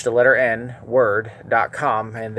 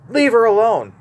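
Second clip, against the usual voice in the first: much higher, loud stern yell; 9 semitones higher, 5 dB louder than usual. Retold or imitated. Preacher showing Jesus yelling at Judas, stern. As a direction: yell it like a command in all caps. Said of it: "He yelled it. He was stern".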